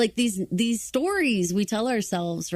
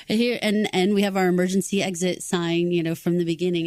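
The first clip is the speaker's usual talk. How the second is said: ordinary talk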